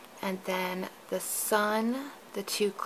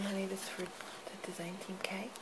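quiet aside; 10 dB quieter than usual. Real, not acted. Aside to someone off camera.

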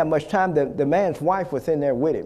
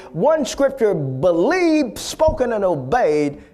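raised, stretched-out preacher's declaration; fervent preaching; 4 semitones higher than usual. Real, not acted. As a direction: build the promise with drawn-out, emphatic conviction. Preaching at full force.

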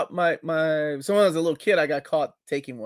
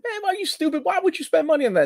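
much higher, incredulous mock scolding; imitating a comedian; faster than usual. Retelling a comedian's robot-lady bit, high mock scolding. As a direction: imitate a comic's high-pitched scoffing. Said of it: "He said".

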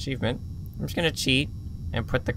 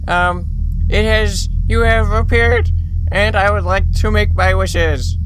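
calling out; 7 semitones higher, 7 dB louder than usual. Real, not acted. Calling to the monster.

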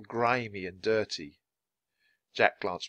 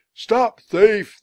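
high, very loud shout; yelling; 11 semitones higher, 10 dB louder than usual. Acted. Cigar seller joining the chase, yelling loudly. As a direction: take up the cry at full volume. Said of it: "the cigar seller yelled".

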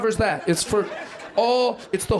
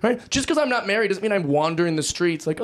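ordinary talk, nothing marked

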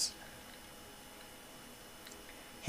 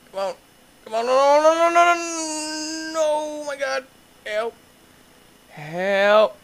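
high, wailing no no no, drawn out; panicked cries; 11 semitones higher, slower than usual. Real, not acted. Player crashing his cart, panicked high wail. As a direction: cry out as the rider goes down.

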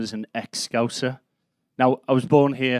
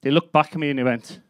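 normal talking voice

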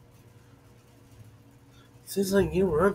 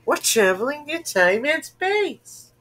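overacted anime dub; 11 semitones higher, 5 dB louder, slower than usual. Voicing game character.

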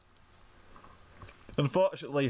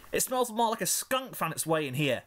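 disgusted filly; 7 semitones higher than usual. Acted. Sweetie voiced, continuing complaint.

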